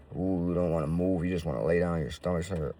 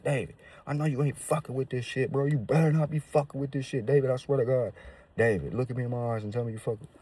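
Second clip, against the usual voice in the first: higher, pressing friend's warning; imitating worried friend; 7 semitones higher than usual. His friend confronting him about using, higher pressing voice. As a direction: lean in and demand the truth.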